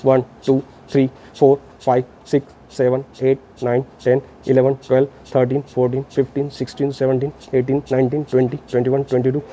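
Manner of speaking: low, even compression count; counting aloud. Shown as a demonstration. Instructor counts thirty chest compressions for trainees, aloud in low rhythm. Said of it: "मैं ज़ोर से बोल रहा हूँ".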